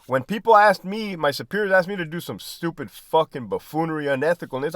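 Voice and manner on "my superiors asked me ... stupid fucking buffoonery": ordinary talk, nothing marked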